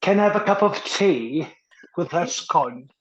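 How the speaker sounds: high, prim English teatime voice; a lightly done posh Englishman; mock posh English. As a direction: put on a proper English accent for a joke. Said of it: "I can't speak like this".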